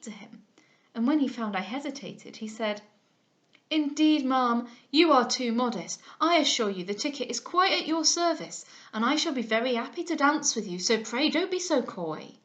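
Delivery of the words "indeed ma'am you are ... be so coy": pushy suitor. Acted. Mr Smith presses the ticket.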